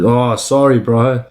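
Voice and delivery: ordinary delivery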